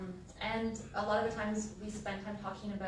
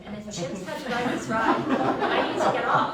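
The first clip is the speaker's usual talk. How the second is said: louder hammed-up demand; mock outrage; 3 semitones higher, 13 dB louder than usual. Sister pranking the ride attendant, loud mock indignation. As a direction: push it up like fake indignation.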